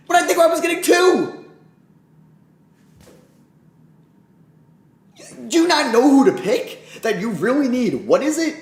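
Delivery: high, loud exasperated yelling; exasperated rant. Real, not acted. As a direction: scold the front office. Yelling at the front office.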